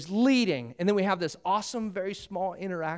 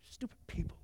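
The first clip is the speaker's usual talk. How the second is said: muttered aside; 4 semitones higher, 8 dB quieter, faster than usual. Acted. Joshua's imagined muttered aside.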